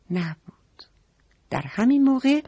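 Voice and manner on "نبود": breathy secret whisper; hushed storyteller whisper. Shown as a demonstration. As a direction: whisper it like a secret to little listeners. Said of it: "storyteller drops to whisper".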